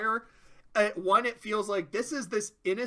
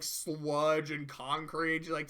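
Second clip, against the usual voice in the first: ordinary delivery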